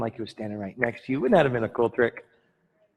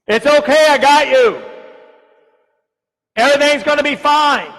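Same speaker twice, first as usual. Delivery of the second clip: loud, high, overblown reassurance; God shouting comfort, hammed up; mock shouted comfort; 13 semitones higher, 12 dB louder than usual. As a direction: yell the comfort absurdly. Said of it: "God's not gonna shout to you".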